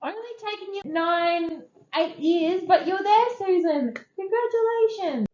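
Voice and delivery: high, sing-song teasing cheer; mock congratulations. Friend teasing her about grief, high sing-song cheer. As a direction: rib yourself with a bright mock hooray.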